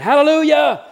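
high, loud desperate yell; a sudden panicked shout; yelled hallelujah. Retold or imitated. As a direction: shout it to stop a runaway mule. Yelling to stop the mule.

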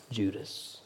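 hushed, breathy reveal of one name; whisper. Acted. Preacher whispers the betrayer's name to the congregation. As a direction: whisper the answer like a secret.

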